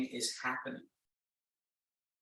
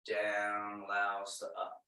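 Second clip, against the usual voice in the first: soft lower repeat; 4 semitones lower, 6 dB quieter than usual. Shown as a demonstration. Instructor repeats the principle to the class, lower and softer. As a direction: lower and softer, letting the words settle down. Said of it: "Emphasis on down".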